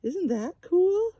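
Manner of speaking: usual speaking voice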